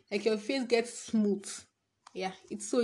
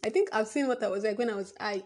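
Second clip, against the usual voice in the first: usual speaking voice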